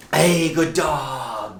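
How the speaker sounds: slow, stretched-out praise; excited dog praise. Shown as a demonstration. Trainer demonstrates marking the dog's response, drawn-out praise.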